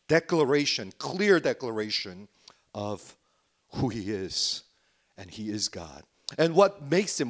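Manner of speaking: ordinary delivery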